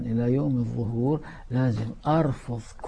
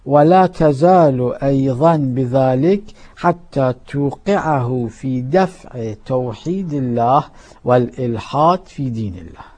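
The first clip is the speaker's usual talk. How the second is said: full-force preaching; 2 semitones higher, 7 dB louder than usual. Acted. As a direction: thunder the warning at the crowd.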